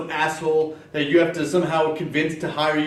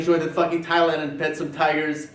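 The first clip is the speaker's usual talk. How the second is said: usual speaking voice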